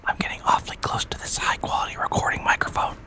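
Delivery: close-mic whisper; breathy whisper into the mic; right in your ear. Real, not acted. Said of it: "I'm whispering in your ear".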